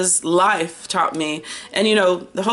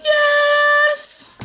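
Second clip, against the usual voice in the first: excited yes; 3 dB louder than usual. Real, not acted. Excited cheer.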